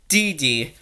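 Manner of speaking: ordinary talk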